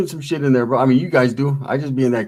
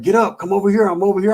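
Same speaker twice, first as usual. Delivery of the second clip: imitating Dubs calling out; 6 semitones higher than usual. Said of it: "And Dubs is like".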